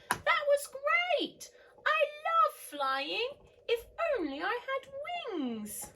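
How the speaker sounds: high, loud, slow and delighted; thrilled slug. Acted. Norman telling the snails he loves flying, high delighted. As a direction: savour the joy of flying. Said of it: "said Norman".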